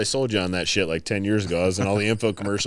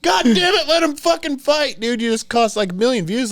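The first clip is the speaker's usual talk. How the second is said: mock frustration; 5 dB louder than usual. Annoyed rant after fight broken.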